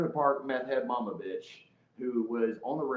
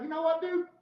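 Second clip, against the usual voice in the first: high-pitched, indignant retort; worked-up retold kiss-off; defiant; 11 semitones higher than usual. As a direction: fling it back, pitched high. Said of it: "I was like".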